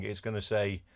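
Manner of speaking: ordinary delivery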